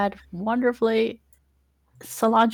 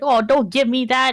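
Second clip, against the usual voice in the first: higher, louder mock scolding; scolding uncle; 6 semitones higher, 5 dB louder than usual. Uncle in back and forth with Cilantro, mock scolding. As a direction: tell off a cheeky animal, pitched up. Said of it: "and he's like".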